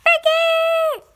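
loud, high cry of a name; anguished shout. Acted. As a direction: yell the name in despair. Gerald calls out in dismay.

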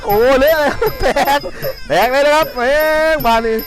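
high, loud cheering commentary; excited shouting. Real, not acted. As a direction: shout the fight as it breaks open. Cheering knockdown, blood spilling.

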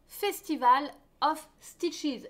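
English title in a French accent. Real, not acted. Host announcing the next shawl's name, French-accented English. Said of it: "mon accent est terrible".

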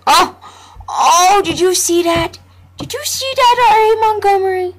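very high, loud, excited call; thrilled shout. Real, not acted. Boasting to the game's narrator, excited. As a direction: crow about it with delight. Thrilled at his own move.

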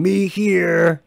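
loud, high ogre call back; ogre answering. Acted. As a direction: holler back as a simple-minded ogre. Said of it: "kinda respond".

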